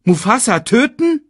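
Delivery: very high, loud question; Banzai. A narrator's reading. Hyena asking the boss, high and incredulous. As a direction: throw it back in disbelief. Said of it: "fragte Banzai".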